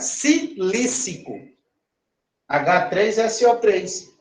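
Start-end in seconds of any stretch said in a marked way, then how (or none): 0.0-1.3 slow demo. Shown as a demonstration.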